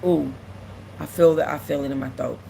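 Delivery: fighting back real tears; quiet, lump in the throat; about to cry. Real, not acted. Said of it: "I feel like I'm about to cry".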